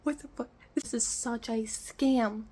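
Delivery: mock furious, slowed on the scam line; half-acted outrage at the mover company; retold livid outburst. Retelling her anger at the refund scam on the phone, a mock furious outburst. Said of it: "I was over there livid".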